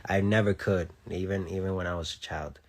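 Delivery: normal talking voice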